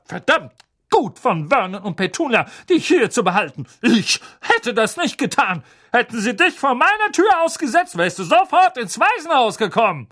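high, loud contemptuous scolding; scornful aunt. Acted. Aunt scolding Harry about the orphanage. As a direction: lay into him. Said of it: "knurrte Tante Magda".

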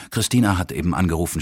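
plain reading voice

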